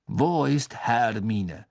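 very slow, deliberate question; foreign champion's voice. Acted. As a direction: spell it out slowly, heavily. Krum, the foreign champion, asks.

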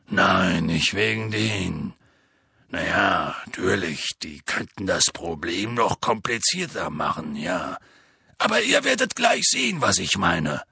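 Hagrid. Acted. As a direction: brush it off in a deep voice, then build. Voices Hagrid in the story. (tiefe Hagrid-Stimme, abwehrend)

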